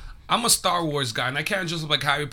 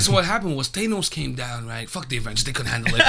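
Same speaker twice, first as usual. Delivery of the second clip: flat, laid-back monotone storyteller; a lightly voiced cool monotone; 6 semitones lower, 5 dB quieter than usual. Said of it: "He has the coolest monotone voice".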